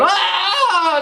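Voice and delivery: sudden high yell of exasperation; frustrated yell. Retold or imitated. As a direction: let out a fed-up parent's cry. Exasperated dad with pestering daughter.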